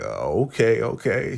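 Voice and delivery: lightly acted surprised double take; a hint of stunned surprise. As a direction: retell his caught-off-guard okay, okay. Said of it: "So he's real surprised".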